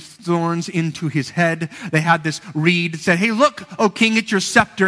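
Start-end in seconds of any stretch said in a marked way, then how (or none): 3.2-4.9 higher, louder sneering taunt; mock-royal taunt, thrown up high; mocking soldiers. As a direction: jeer at him as a fake king. Soldiers mocking Jesus as king.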